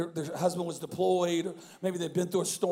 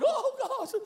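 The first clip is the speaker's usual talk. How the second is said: high-pitched imitated mother's gasp; gushing mom. Imitating a mom seeing the host, high gushing. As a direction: swoon high like a thrilled mom. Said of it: "The mom's like".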